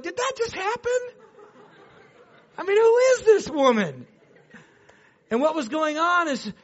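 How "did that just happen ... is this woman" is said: high, laughing and stunned; laughing disbelief. Retold or imitated. Neighbors retelling a run-over garbage can, laughing in disbelief. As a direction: laugh out the shock of it. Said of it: "We look at each other like".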